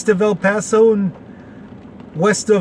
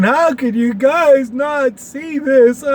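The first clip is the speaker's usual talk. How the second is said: higher, slow whining complaint; mock whine; 6 semitones higher, slower than usual. Mimicking the complaining side, higher slow mock whine. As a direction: whine it like a sore loser, hammed up. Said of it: "one side crying and complaining and going".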